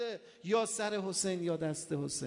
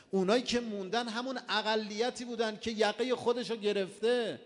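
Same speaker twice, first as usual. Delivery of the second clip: louder, driving pulpit sermon cadence; preaching at full force; 2 semitones lower, 5 dB louder than usual. Acted. Preacher warning the mourners, at full pulpit force. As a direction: push the moral home to the congregation.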